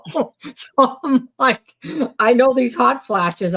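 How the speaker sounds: high, amused, through laughter; laughing. Real, not acted. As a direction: say it through a giggle. Laughing while saying it.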